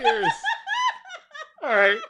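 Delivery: laughing fit. Real, not acted. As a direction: try to recover but keep cracking up.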